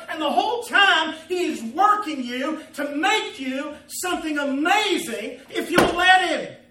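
loud, high, fervent proclamation; impassioned preaching. Real, not acted. Preacher promising God is working on you, full-force preaching.

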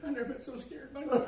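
high-pitched, through laughter; laughing retelling. Retold or imitated. Storyteller quoting the frightened new recruit, high and laughing. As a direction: quote the scared recruit while cracking up.

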